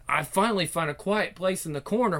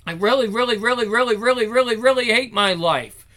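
high, rhythmic run of repeated words; exasperated outburst; 5 semitones higher, 3 dB louder than usual. Acted. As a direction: pile up each word in frustration, then land it. Girl's exasperated inner outburst.

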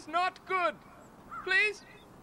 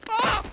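pained cry; 4 semitones higher, 6 dB louder than usual. Acted. Man breaks his legs.

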